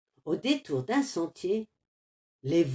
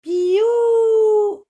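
long, very high, loud chick cry; chick peep; 12 semitones higher, 10 dB louder than usual. Acted. La poussine refuse. (le poussin crie piou, très aigu)

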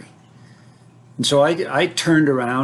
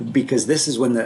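ordinary talk, nothing marked

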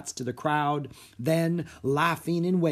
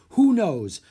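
higher, loud outburst; exasperated cry; 6 semitones higher, 8 dB louder than usual. A narrator's reading. Theater manager has no answer, crying out. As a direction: cry out helplessly. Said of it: "cried Mister Dewey".

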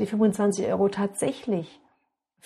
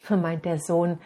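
ordinary delivery, nothing marked

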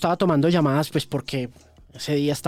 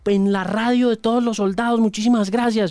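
higher, formal soldier thanking the host; army-style greeting; 8 semitones higher than usual. Retold or imitated. Soldier thanking the host on New Year's Eve, higher army-style tone. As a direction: salute him with army formality. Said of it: "saludo así de ejército".

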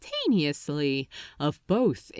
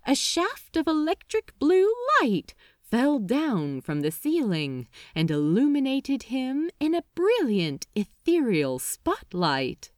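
ordinary delivery, nothing marked

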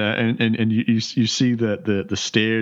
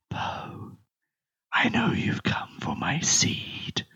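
mysterious voice whispering in the mind; eerie whispering voice. Acted. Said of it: "you hear a whispering in your mind".

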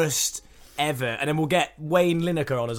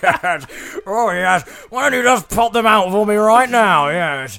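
a hammed-up, louder David Dickinson impression, sung at the start; lightly put-on cheeky antiques dealer, louder than usual; 4 semitones higher, 8 dB louder than usual. Podcast host doing his worst impression of the antiques presenter, hammed up. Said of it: "that is the worst impression ever".

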